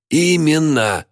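very high, triumphant emphatic cry; strengthened voice. A narrator's reading. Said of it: "Голос Джеральда прояснился и окреп".

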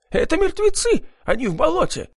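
shrill, very high terrified wail, voice gone strange; wild panicked howl. A narrator's reading. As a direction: scream in raw panic. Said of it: "заблажил дурным голосом".

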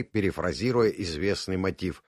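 plain reading voice

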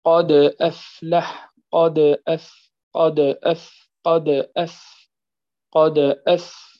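قد أفلح repeated slowly, the د in قد bounced lightly; repeated qalqala drill. Shown as a demonstration. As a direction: drill قد over and over, echoing the د. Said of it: "هذه الداء صح هي في آخر كلمة". (تكرار قد مع قلقلة صغرى للدال)

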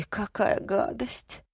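soft, slow revulsion; quiet, drawn-out distaste; exhaled disgust. A narrator's reading. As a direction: let it out slowly on a weary breath. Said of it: "выдохнул Григорий".

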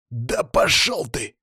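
slow, strained snarl; defiant snarl. A narrator's reading. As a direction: snap back through the pain. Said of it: "огрызнулся Алексей".

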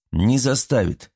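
disbelieving. A narrator's reading. Artyom rejects Svat's warning about the gods, mildly disbelieving. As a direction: doubt it, drawing the words out. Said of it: "не поверил Артём".

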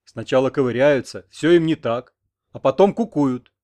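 loud, aggrieved complaint; offended. A narrator's reading. Grandfather complains about picky girls, loudly offended. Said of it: "обиделся дед за внука".

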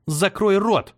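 harsh, high sharp command; stern. A narrator's reading. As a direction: snap it sternly. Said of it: "Её голос звучал сурово".

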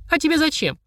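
put-on high, suspicious little girl. A narrator's reading. Girl guarding the book, high suspicious question. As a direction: question him warily in a child voice. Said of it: "подозрительно спросила".

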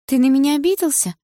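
put-on high, rising girlish question; high-voiced worried question; high-pitched girlfriend question. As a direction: ask him in a high, pleading pitch. Girlfriend's anxious question to boyfriend.

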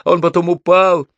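high, loud, urgent shout; yelled reply. A narrator's reading. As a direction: holler the explanation back. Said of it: "заорал я в ответ". (взволнованный крик в ответ)

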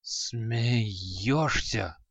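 soft, breathless exhausted question; exhausted gasp. Acted. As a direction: gasp it out, spent. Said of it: "с трудом выдохнул Креол". (еле выдохнутый вопрос)

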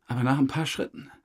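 ordinary delivery

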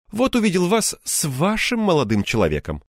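вашим singled out with pointed emphasis; stress on вашим. A narrator's reading. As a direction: lean pointedly on the word вашим. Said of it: "он особенно выделил".

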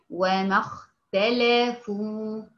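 وما اختلفوا with a full heavy خ, slow; heavy kha. Shown as a demonstration. Teacher demonstrating the heavy خ to her student. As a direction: stretch out the خ in اختلفوا with full emphasis. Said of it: "تنطق الخاء في هذه الحالة تفخيم حقيقي".

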